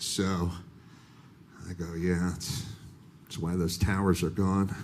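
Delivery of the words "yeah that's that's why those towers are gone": much softer and lower, dry and offhand; low muttered wisecrack to the agent; mumbled deadpan reply. Retold or imitated. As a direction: toss it off under your breath.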